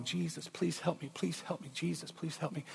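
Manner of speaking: soft, pleading prayer through pain. Retold or imitated. Woman in pain at midnight prays softly, pleading. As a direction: beg quietly for help. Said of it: "she's just rocking".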